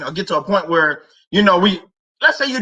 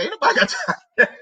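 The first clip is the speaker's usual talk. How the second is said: laughing meme impression; 11 semitones higher than usual. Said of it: "She went there and say".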